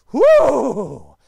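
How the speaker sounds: loud, excited whoop; whoop of joy. Real, not acted. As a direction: let out a gleeful woo. Reliving pizza joy.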